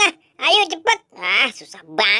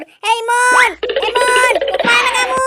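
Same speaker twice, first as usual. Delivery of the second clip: higher, louder shout after them; calling out angrily; 9 semitones higher, 3 dB louder than usual. Acted. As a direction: yell after the runaways. Boboiboy chasing the thieves. (teriakan memanggil marah)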